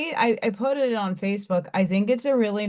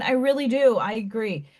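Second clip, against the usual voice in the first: ordinary talk, nothing marked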